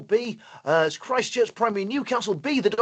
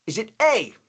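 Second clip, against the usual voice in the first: ordinary talk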